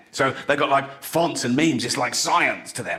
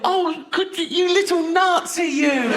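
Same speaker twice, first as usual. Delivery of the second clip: put-on much higher cooing baby talk, slowing down; doting baby voice, much higher; slower than usual. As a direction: coo at a baby you meant to strangle. Baby talk to infant Hitler.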